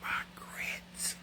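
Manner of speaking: soft, furtive whisper; whispered confession. Real, not acted. Host admitting where else the sugar goes, whispered. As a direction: whisper it like a guilty secret. Confessing a disputed food habit.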